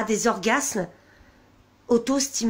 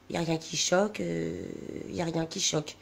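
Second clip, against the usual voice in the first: low and much softer, repeated under the breath; subdued dismayed aside; 5 semitones lower, 9 dB quieter than usual. Real, not acted. Vlogger aghast at what was just read, quietly muttering. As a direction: drop pitch and volume, halting between repeats.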